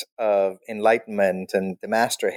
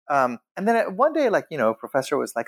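ordinary delivery

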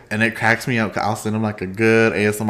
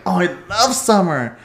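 higher, mock cheerful summer fan; mock summer lover; 6 semitones higher than usual. To co-host, mocking summer fans, chirpy mock tone. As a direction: parody the summer lover, up high. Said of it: "If I see anyone like".

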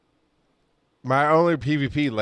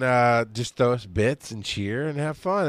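normal talking voice

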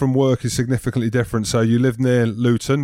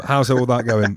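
ordinary talk, nothing marked

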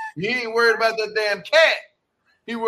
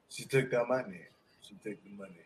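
much lower and softer, a mock-sad mumble; lightly voiced dejected man muttering; mopey husband; 12 semitones lower, 11 dB quieter than usual. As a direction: play him moping like a sad dog.

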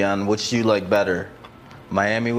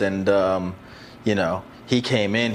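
usual speaking voice

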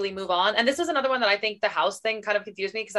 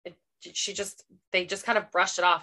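ordinary talk, nothing marked

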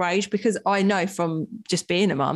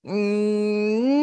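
normal talking voice

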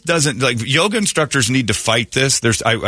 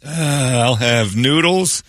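higher, slower voice picking out dishes; mock buffet diner; 5 semitones higher than usual. Host playing a diner choosing gross buffet mixes, higher voice. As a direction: list the food like a dazed diner at the buffet.

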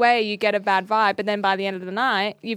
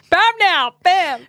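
high, loud gloating cry; triumphant bam; 8 semitones higher, 6 dB louder, slower than usual. Acted. To her co-host after proving free will a myth, loud triumphant cry. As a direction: slam it home like a winning point, laughing.

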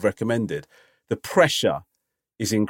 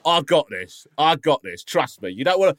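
insistent host takeover; 3 semitones higher than usual. Acted. Acting host taking over ordering.

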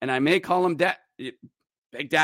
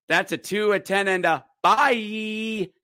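announcer call; 4 semitones higher, slower than usual. Acted. Repeats penalty call drawn out.

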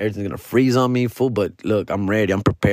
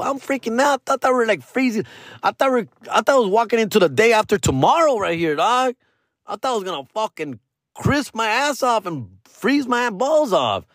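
panicky retelling; 6 semitones higher than usual. Retold or imitated. Panicked quote about freezing weather.

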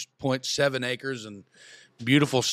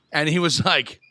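ordinary delivery

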